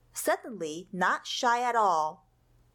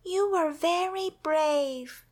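comforting; 6 semitones higher, slower than usual. Acted. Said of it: "said Millie, hugging him".

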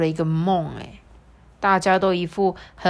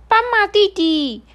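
high parrot voice; 14 semitones higher, 7 dB louder than usual. Acted. Parrot calls the zebra.

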